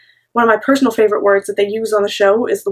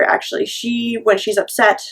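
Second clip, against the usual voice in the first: ordinary talk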